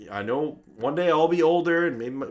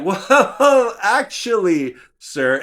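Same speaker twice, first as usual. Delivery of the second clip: loud interrupting correction; 5 semitones higher, 7 dB louder, much slower than usual. Retold or imitated. Piping in to correct.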